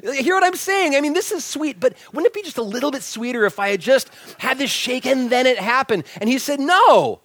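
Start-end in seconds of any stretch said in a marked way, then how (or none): 0.0-6.1 excited playful insisting; arguing excitedly. Retold or imitated. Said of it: "we got in this big argument". 6.6-7.2 much louder, higher flat no; fired-up retort. Retold or imitated. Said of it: "He was, like, fired up".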